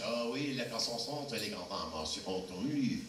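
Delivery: mock French gibberish, lightly voiced; a hint of fake French. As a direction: bluff through in made-up French. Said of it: "I had to fake every language".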